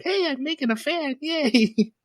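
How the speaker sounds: put-on squeaky high, bouncy girlish chirp; playful Chika voice. Retelling anime, voicing Chika with a high squeal. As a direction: pitch way up like a giddy cartoon girl. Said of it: "she's like".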